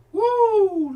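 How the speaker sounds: high, loud whoop; a high, loud joyful cry; preacher's whoop. Real, not acted. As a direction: let out a holler of spirit. Preacher exclaiming after scripture.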